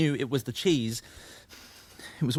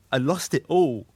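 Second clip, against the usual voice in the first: losing composure; 4 semitones higher, 4 dB louder than usual. Acted. Confessing a breakdown to Annie, losing composure. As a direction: voice starting to rise, slightly louder. Admits a breakdown, first take.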